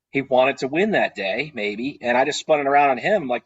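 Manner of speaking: ordinary delivery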